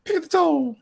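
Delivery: high, squeaky troll demand. Acted. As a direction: squeak it out like a tiny troll doll.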